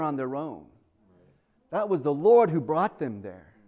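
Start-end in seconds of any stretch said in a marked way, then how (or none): none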